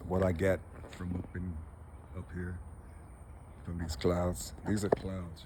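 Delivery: low, subdued, halting, real; fading to a hushed, choked-up near-murmur; emotional. Real, not acted. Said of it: "this damn emotional".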